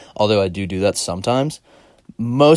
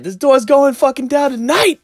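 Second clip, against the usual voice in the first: high, loud threat; mock enraged shriek; imitated screaming; 7 dB louder than usual. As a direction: mimic a furious woman at a locked door. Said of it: "I remember hearing her say".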